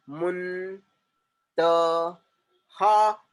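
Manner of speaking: मुन, त, हा as separate held sounds; syllable-by-syllable split. Shown as a demonstration. Teacher repeating the new sounds for students, slow syllable split. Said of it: "नए नए sound निकल कर आ रहे हैं".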